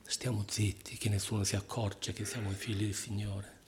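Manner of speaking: hushed, low and soft; mezzo sussurro. Acted. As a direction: keep it down so nobody notices. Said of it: "O sussurriamo solo?". (voce bassa, quasi sussurrata)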